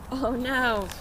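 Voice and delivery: usual speaking voice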